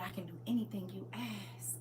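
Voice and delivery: whispered, reverent; whispered quote. Acted. Scene: quoting the Lord's promise to the viewers in a whisper. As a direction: hush it like a promise.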